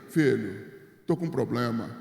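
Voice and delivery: a low, grave pastor's voice; pastor Tonhão's way. Retold or imitated. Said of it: "o pastor Tonhão falou assim pra mim". (imitando o pastor Tonhão com voz bem grave)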